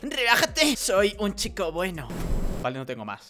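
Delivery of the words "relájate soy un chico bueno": put-on narrator voice. Gamer voices the narrator with limited range, put-on voice. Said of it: "Es mi registro de voces, es muy limitado".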